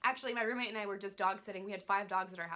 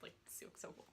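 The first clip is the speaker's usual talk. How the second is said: whispered wish; 19 dB quieter than usual. Real, not acted. Daughter telling mom she'd love a sister, whispering. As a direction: breathe it as a secret hope.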